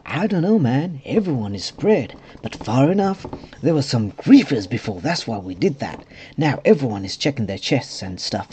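swooping put-on character voice; silly voice. Realm owner explaining about griefers, silly voice. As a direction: voice him silly, pitch leaping up. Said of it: "silly voice on".

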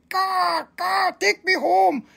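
high squawking bird voice, shouted; bird cawing. Pretend. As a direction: caw like a crow begging to go home. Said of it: "It's going".

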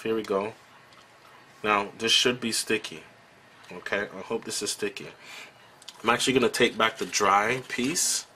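ordinary delivery

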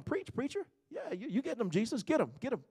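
high, soft egging-on voices; hammed-up amen corner; mock Pharisees cheering. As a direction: cheer him on like a heckler in the pews. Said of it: "they're probably going".

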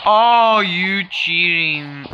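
loud high groan, slowly falling away; aggrieved complaint. Real, not acted. Gamer accusing the opponent of cheating, aggrieved complaint. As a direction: drag out a sore-loser accusation.

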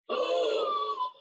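very high startled gasp. Acted. Reader gasps seeing fire-breathing dragons. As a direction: gasp sharply in surprise.

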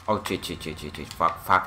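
low, hushed string of swearing; muttered cursing. Real, not acted. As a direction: curse under your breath while under fire. Under fire in the game.

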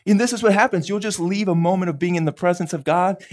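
ordinary talk

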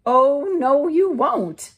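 high, loud, slow refusal; firm little red hen. Acted. The hen refusing the lazy animals, loud and firm. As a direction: put them in their place.

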